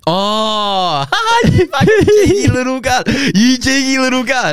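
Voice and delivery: high, laughing mock outrage; laughing outburst. Real, not acted. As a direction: cackle it out at your mate. Friend's cheeky fine, laughing outburst.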